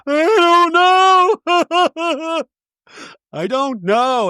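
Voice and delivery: high, drawn-out mock wail; hammed-up crying. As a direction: imitate a sudden tearful meltdown, wailing. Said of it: "goes from bubbly effervescent to".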